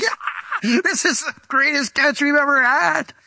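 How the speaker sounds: high, squeezed mock cheer; excited whooping. Soaked fishermen hauling in a huge catch, excited high whooping. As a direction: celebrate in a high-pitched, hammed-up whoop. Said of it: "could you imagine how excited they were".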